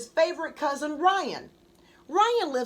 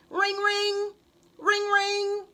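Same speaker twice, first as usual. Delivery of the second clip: higher sing-song ring ring; phone ring sound; 7 semitones higher, slower than usual. A narrator's reading. Narrator mimicking the phone ringing, sing-song.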